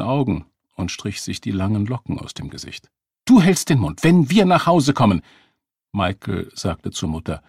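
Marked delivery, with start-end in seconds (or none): 3.3-5.2 loud, high reprimand; angry scolding. A narrator's reading. Mother silencing her daughter, angry.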